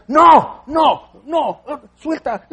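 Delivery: grito agudo y fuerte de niño que se suelta; niño rebelde gritando. Retold or imitated. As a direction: haz al niño que jala la mano gritando. Pueblo imitado como niño rebelde. (niño haciendo berrinche a gritos)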